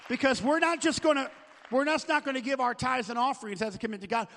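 loud, rising sermon voice; full-force preaching. Acted. Pastor urging the church to sign commitments, loud preaching. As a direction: drive it home to the congregation.

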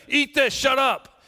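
high, loud, slow mock-parent bark; hammed-up grumpy parent in the car; mock parent snap. Parent snapping in the back seat.

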